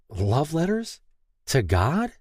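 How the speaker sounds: lightly voiced, echo it in confusion; a touch of disbelief; puzzled question. Lay confused.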